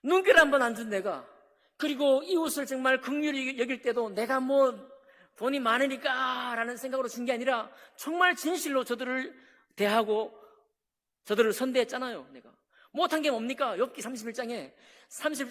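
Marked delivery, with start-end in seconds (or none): none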